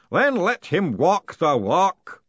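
slow, loud ritual order; loud, drawn-out ceremonial voice; booming command. A narrator's reading. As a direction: command it grandly, drawing out each word. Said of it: "the unseen voice commanded".

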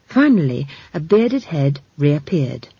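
ordinary delivery, nothing marked

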